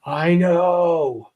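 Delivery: drawn-out "I know", both words stretched; knowing acknowledgment. Acted. Teacher voicing what the meteg mark says, drawn-out knowing tone. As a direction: voice the scribe's long-suffering admission. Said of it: "Meteg, written beside a letter, means".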